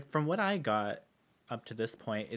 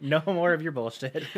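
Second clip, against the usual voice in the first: angry; 3 semitones higher, 6 dB louder than usual. Retold or imitated. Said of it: "that lady was pissed. She was like".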